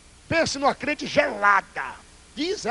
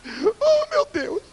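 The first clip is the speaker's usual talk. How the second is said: much higher, crying plea for mercy; whiny pleading presbyter; 11 semitones higher than usual. Retold or imitated. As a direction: imitate a grown man whimpering and begging. Presbyter keeps begging, voiced in story.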